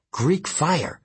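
ordinary delivery, nothing marked

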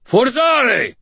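high, loud shout of a spell word; screamed spell. A narrator's reading. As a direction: scream it out as a magic command. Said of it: "and screamed".

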